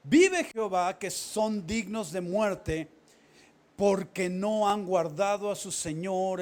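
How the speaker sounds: slow, loud oath, dragged out; thundering accusation; shouted oath. Acted. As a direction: swear it loudly at Abner. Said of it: "Y dijo David a Abner".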